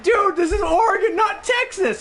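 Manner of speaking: high, incredulous protest; mock exasperated shout. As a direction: exclaim it high-pitched at the clerk. Acted protest at bad accent.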